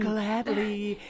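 ordinary talk

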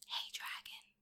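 hushed, voiceless whisper; very soft whisper. A narrator's reading. Elizabeth testing the sleeping dragon, whispered very softly. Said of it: "Elizabeth whispered very softly".

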